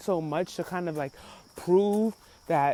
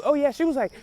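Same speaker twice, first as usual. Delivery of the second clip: ordinary talk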